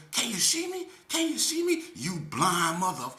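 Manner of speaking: high, loud sing-song mockery; mocking taunt. Woman mocking the speaker's thick glasses, high and loud. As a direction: tease him about his glasses. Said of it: "So she gonna say".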